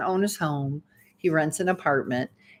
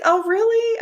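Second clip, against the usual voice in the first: mock surprise. Feigned surprise at his claim.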